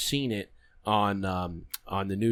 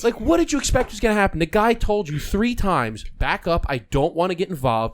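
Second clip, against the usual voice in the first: loud, raised voice of mock outrage; exasperated disbelief; 7 semitones higher, 5 dB louder than usual. Scolding the guy who was warned three times, exasperated. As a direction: berate him as if it were obvious. Scolds the shot man in retelling.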